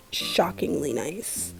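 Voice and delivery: ordinary talk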